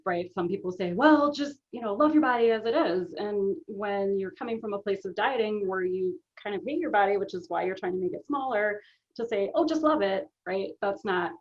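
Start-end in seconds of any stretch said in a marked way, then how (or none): 0.9-3.1 higher-pitched mock breezy advice; glib advice. Coach quoting what some people say, glib advice. 9.5-10.2 high, breezy mock advice; breezy dismissal. Coach quoting advice she rejects, mock breezy.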